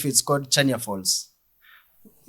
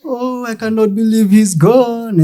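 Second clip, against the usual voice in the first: mock grieving mourner; 8 semitones higher, 5 dB louder than usual. Mourners crying at the funeral.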